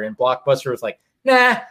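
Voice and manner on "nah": high, smug brush-off; smug dismissal. Retold or imitated. Blockbuster turning down Netflix, smug higher brush-off. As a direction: wave it off like you know better.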